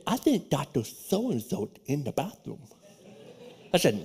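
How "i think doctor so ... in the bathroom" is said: soft, near-whispered retelling; hushed whisper. Retold or imitated. Telling his friend to slip out, hushed whisper. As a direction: keep it hushed so the professor won't hear. Whispering about professor in stall.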